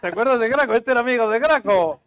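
light up at the memory; excited. Real, not acted. Repeats the question, rising.

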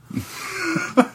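high-pitched burst of laughter; real amused squeal. Real, not acted. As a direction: laugh at your own impression. Laughing at accent imitation.

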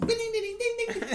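very high sung dings; high bell ding. Pretend. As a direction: chime like a bell. Imitates bell chime.

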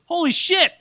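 high squeaky holy shit; mock amazement way up high; imitating the fan's surprise. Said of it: "And he goes".

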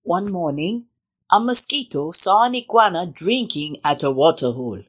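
ordinary delivery

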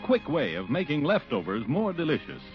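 normal talking voice

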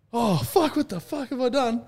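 high, loud mock-panicked outburst; retold panic. Player telling mates how he woke injured, high retold panic. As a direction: blurt it high, replaying the morning-after shock.

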